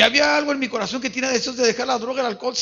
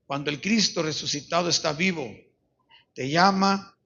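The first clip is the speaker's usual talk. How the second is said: lower, measured appeal with long pauses; solemn altar call; 4 semitones lower than usual. Real, not acted. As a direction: invite them gravely to search their hearts. Solemn closing appeal to congregation.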